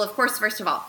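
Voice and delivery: ordinary delivery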